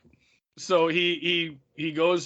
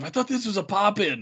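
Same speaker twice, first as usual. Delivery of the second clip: higher-pitched mock complaint; imitated griping; 5 semitones higher than usual. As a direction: gripe like a put-out husband at his wife. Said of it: "He's, like, bitching at his wife".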